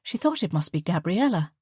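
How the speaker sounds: plain reading voice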